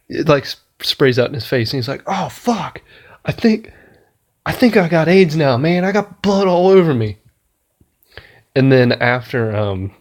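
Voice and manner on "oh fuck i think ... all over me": a pitched-up, hammed-up freak-out; mock panic. Guy covered in blood, mock panicked freak-out, pitched up. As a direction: squeal it like you just got splashed with blood. Retold panicked movie character.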